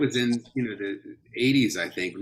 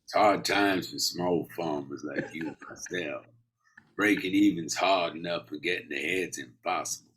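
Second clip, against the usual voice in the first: put-on Southern accent; 3 semitones lower than usual. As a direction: speak as a Southern small farmer. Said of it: "have got the Southern accent down".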